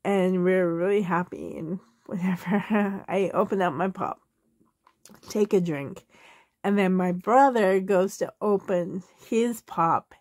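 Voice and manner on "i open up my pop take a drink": usual speaking voice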